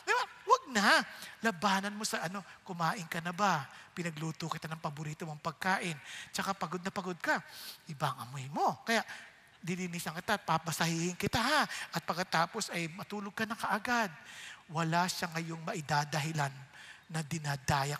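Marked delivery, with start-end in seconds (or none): 2.7-14.1 tender wife. Pretend. Wife answers husband with kindness.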